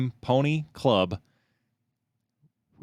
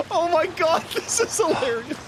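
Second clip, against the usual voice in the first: high voice breaking with laughter; laughing hard. Real, not acted. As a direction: squeak it out while cracking up. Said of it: "laughing at the video".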